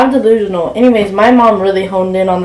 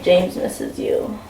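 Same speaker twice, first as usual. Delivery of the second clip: slow, soft whisper; voice in her head; 7 dB quieter, slower than usual. Acted. Her own head urging her toward James, whispered. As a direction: whisper it slowly like an inner voice. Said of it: "in my head that's telling me".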